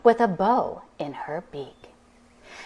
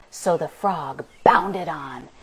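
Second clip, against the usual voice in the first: usual speaking voice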